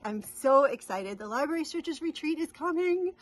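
excitement climbing to a high squeal; gush, pitch rising to the end; real excitement. Real, not acted. Stitcher announcing the coming retreat, squealing excitement. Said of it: "just excited".

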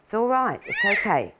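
gentle high comforting voice; reassuring pet talk. Real, not acted. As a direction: tell the creature it's fine. Reassuring the animal.

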